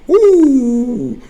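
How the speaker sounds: loud high excited whoop. Real, not acted.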